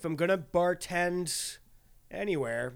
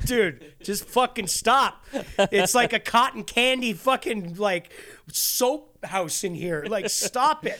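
mock scolding; 5 semitones higher, 5 dB louder, slower than usual. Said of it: "And I'm like".

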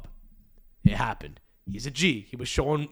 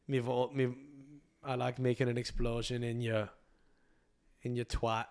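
softly put-on English accent; shaky English regional accent; 7 dB quieter than usual. Comic playing Jack Grealish flirting, in a put-on English accent. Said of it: "I don't know where I'm from in England".

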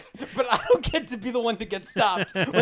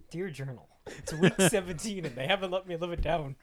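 mock diary voice; 4 semitones lower, 6 dB quieter than usual. Mock diary entry, sad.

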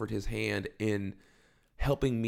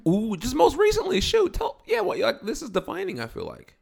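much higher, thrilled rush of words; excited; 13 semitones higher, 5 dB louder than usual. Real, not acted. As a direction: gush about it with excitement. Excited about friend's business.

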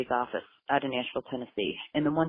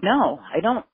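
higher and louder, refusing; defiant outburst; 6 semitones higher, 6 dB louder, slower than usual. Retold or imitated. Mother answering the investigator, loud defiant no. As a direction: snap back hard at being told to calm down.

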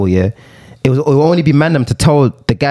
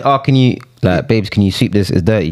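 put-on sweet girlfriend request; 7 semitones lower, slower than usual. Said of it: "if she said".

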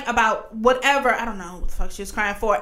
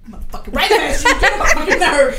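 mock angry outburst; 4 semitones higher, 6 dB louder, faster than usual. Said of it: "she's like".

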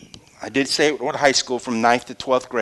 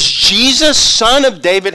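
high, loud plea; crying out; 8 semitones higher, 7 dB louder, slower than usual. Retold or imitated. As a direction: call out for help. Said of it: "he begins to cry out".

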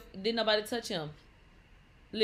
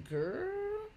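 shocked; 11 semitones higher, 5 dB quieter than usual. Real, not acted. Shocked reaction.

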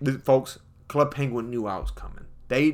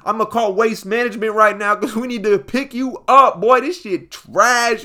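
roasting; 7 semitones higher, 6 dB louder than usual. Retold or imitated. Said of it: "started roasting my boy".

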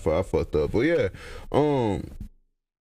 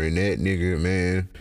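ordinary delivery

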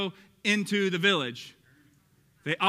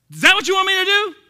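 put-on Texas accent; 13 semitones higher, 11 dB louder than usual. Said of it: "I just give it a little Texas accent when I get a little excited".

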